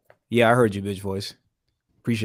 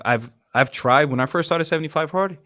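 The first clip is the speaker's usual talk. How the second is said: ordinary delivery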